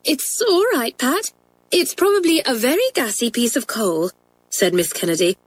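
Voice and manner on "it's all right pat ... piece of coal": high-pitched schoolmistress, reassuring a pupil; calm the girl in a high teacher's voice. A narrator's reading. Teacher explains the bangs to Pat, high-pitched reassurance.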